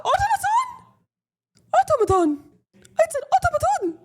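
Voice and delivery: very high, loud mocking voice; hammed-up high robot word; mock squeaky insistence. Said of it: "That's not how I fucking talk".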